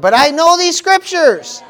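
high, loud, drawn-out protest. Acted. Preacher voicing a believer caught out by trial, loud protest. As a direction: protest it slowly at the top of your voice.

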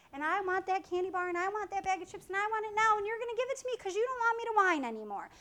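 put-on high, fast, breathless kid's demand; whining child. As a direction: rattle off every want like a greedy child.

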